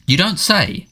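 high-pitched, exasperated retort; furious retort. A narrator's reading. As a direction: throw it back with restrained fury. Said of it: "said the Prime Minister furiously".

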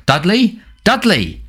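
high calls, rising then falling; Harry calling for Dudley while running, high. Acted. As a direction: call out searching for him.

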